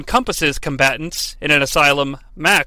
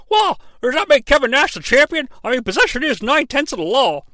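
higher, smug pundit voice; Mark Madden; 8 semitones higher than usual. Retold or imitated. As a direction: toss it off like a know-it-all commentator. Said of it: "Mark Madden might say".

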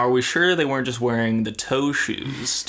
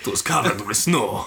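put-on Russian accent; 2 semitones lower than usual. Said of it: "do a Russian accent".

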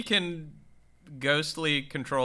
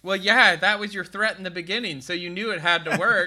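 higher, louder deadpan sarcasm; sarcastic; 5 semitones higher, 5 dB louder than usual. Retold or imitated. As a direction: point out the obvious sarcastically. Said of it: "And it's like".

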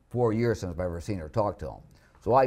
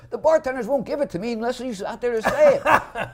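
pitched way up, whining through laughter; high whiny complaint, laughing; 6 dB louder than usual. Retold or imitated. Retold drunk comic complaining.

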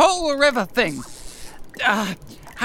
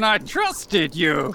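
ordinary talk

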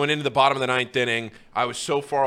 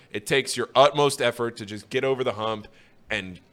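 normal talking voice

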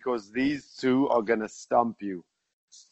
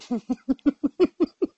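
high squealing laugh. Real, not acted. Laughs at accent echo.

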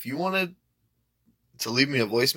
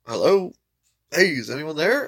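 higher, louder, questioning pickup; pretend phone answer; 5 semitones higher, 3 dB louder than usual. Prank voicemail fooling callers, puzzled pretend answer. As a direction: call down a seemingly silent line, puzzled. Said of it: "changed it to fuck with people".

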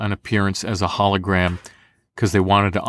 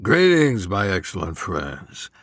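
George Carlin; 3 semitones lower, slower than usual. Acted. Voice actor showing his Rufus voice match, comedian impression. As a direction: greet grandly, then slow and sink in pitch. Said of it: "I did George Carlin for that".

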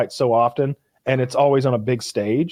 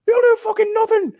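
high, loud mock Irish fans laughing; imitating Irish fans; 4 dB louder than usual. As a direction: chant it like rowdy fans, laughing. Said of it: "they're like".

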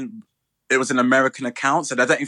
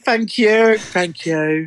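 a higher, put-on Katie Price thank you; a quick mock Katie Price thanks, first one louder; Katie Price impression; 7 semitones higher, 3 dB louder, much slower than usual. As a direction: try to sound like Katie Price saying thanks. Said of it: "That was me trying to do Katie Price".

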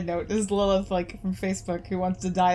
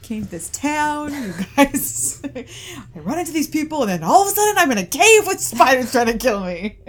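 exasperated complaint; 7 semitones higher, 6 dB louder than usual. Acted. Character complaining about misfortune.

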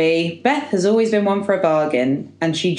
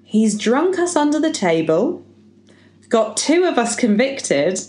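higher, theatrical birthday speech delivery; speech reading; 8 semitones higher than usual. Acted. Podcaster reading out a birthday speech, performed oratory. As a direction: deliver it to the room as a rousing speech.

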